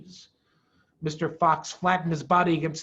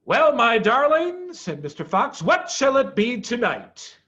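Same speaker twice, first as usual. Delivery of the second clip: woo his wife playfully; charming Mr Fox; 6 semitones higher, 6 dB louder than usual. Acted.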